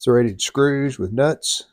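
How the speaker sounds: normal talking voice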